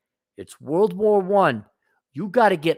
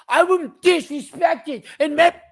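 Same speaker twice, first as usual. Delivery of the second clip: high, slow put-on whine; mock whiny complainer; 11 semitones higher, 5 dB louder, slower than usual. Mocking the whiny employee in a high voice. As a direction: mimic a self-pitying worker.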